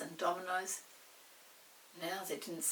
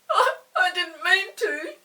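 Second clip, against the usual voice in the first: high, slow, loud tearful stammer; almost in tears; 10 semitones higher, 16 dB louder, slower than usual. Acted. Molly excuses herself to Peter, almost crying. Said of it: "almost in tears".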